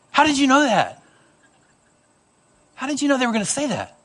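put-on little kids astonished at their father's trick; amazed kids. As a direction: ask it wide-eyed like a child.

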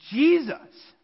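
Jesus. called out high and loud; proclaimed name. Acted.

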